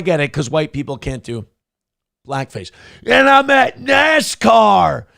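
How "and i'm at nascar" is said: higher, slow, hammed-up announcement; mocking Drewski. Comic mocking a whiteface bit, higher and slow. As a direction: spell out the obvious joke, higher and slow.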